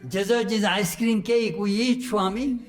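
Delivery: loud, high mock scolding through laughter; laughing teasing. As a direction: tease the mix-up while laughing. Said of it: "she scolded me".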